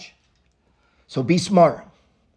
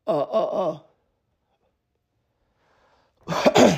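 choking on a drink; 2 semitones lower, much slower than usual. Real, not acted. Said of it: "choking there".

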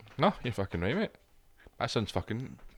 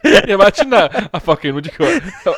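laughing; 9 semitones higher, 8 dB louder than usual. Real, not acted. Laughing at the joke.

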